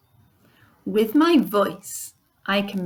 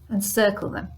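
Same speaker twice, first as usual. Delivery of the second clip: ordinary talk, nothing marked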